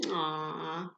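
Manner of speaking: low soft cooing aww; tender aww. Real, not acted. Parent cooing over the bunny kissing the cat, soft aww. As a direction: coo fondly at the cute moment. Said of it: "cooing at story".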